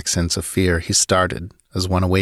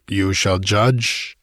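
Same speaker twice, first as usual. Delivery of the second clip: slow, savouring boast; boastful; much slower than usual. Acted. Conan carrying the girl, slow and boastful. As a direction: take your time, he's sure of himself. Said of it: "he boasted".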